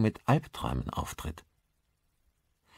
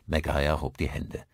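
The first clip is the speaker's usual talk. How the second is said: ordinary delivery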